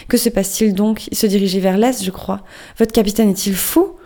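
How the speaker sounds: usual speaking voice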